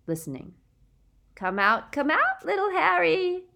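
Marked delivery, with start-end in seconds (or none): 1.4-3.5 high, loud singsong taunting; mock baby voice. A narrator's reading. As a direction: coo it like teasing a baby. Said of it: "she called in her mock baby voice".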